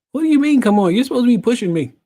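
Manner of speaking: high, loud mock disbelief; retold outraged complaint; indignant protest. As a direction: protest it incredulously, pitch jumping up, laugh creeping in. Acted protest to therapist in story.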